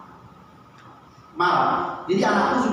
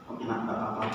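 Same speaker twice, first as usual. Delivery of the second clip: tender comfort; 8 semitones lower, 11 dB quieter than usual. Retold or imitated. Hugging the crying son.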